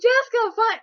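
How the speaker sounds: high loud teasing imitation; mocking her. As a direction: tease her by copying her. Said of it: "copying me, kind of, like, mocking me".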